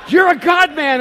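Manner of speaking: high, booming outburst; loud retold exclamation; imitated yell. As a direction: yell it out like an excited stranger. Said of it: "And he yells out".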